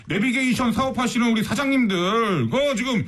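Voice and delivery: hammed-up urging; mock scolding. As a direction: lecture the company bosses in pretend exasperation. Mock scolding of navigation company bosses.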